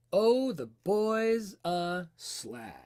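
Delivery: put-on "Oh, the boy's a slag" very slow, each rise and fall drawn out; exaggerated spoken tune. Shown as a demonstration. Teacher showing where speech goes up and down, slow exaggerated intonation on "Oh, the boy's a slag". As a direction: stretch "Oh, the boy's a slag" to trace its natural melody. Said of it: "Notice where you go up and where you go down".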